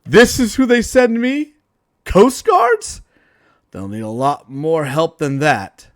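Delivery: taunting villain. Acted. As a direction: sneer at the puny Coast Guard. Villain Voltage taunts the heroes.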